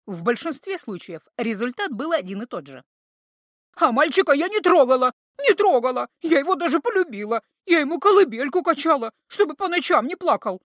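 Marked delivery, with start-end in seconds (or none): 3.8-10.6 loud, high tearful pleading; sobbing desperate plea; crying. A narrator's reading. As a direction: wail through tears. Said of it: "плакала Кикимора".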